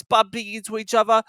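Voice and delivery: plain reading voice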